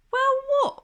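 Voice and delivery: put-on high, lisping girl monkey asking why; a light lisping friend, puzzled; Bubbles, puzzled. Bubbles asking Desmond why she should watch, high and lisping.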